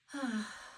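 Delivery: real breathy sigh; heavy sigh. Real, not acted. Speaker pausing over regret about mom, heavy sigh. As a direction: let out a long breath before going on.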